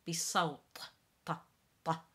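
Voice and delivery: fast بصوت, then ta ta, stressed; emphatic T drill. Shown as a demonstration. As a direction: hit the T of بصوت hard. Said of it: "a little bit more prominence on it".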